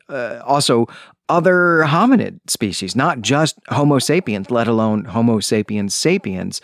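normal talking voice

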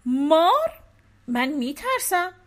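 high, loud alarmed question; shocked at the snake; startled wife. A narrator's reading.

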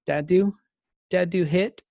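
a hint of a sniffly little one; imitating toddler. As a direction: say it like a tearful toddler tattling. Dad voices toddler telling on him.

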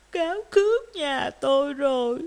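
tearful words between sobs; crying. Acted. Rabbit tells dogs of lost house, sobbing. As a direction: sob out the complaint. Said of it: "thấy thỏ con khóc".

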